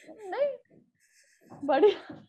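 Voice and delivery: high, airy fib that it was great; husband reassuring. Retold or imitated. Husband telling her the bad show was fine, high reassuring voice. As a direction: play the husband covering it up kindly.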